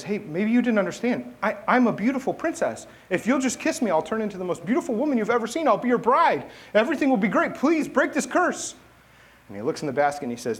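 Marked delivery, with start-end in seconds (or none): 0.0-5.7 high, excited frog voice; pleading frog. Acted. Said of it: "And the frog says". 5.7-6.5 loud, high, eager frog voice; pleading frog. Acted. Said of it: "And the frog says". 6.7-8.7 high, loud comic begging; desperate frog. Acted. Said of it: "And the frog says".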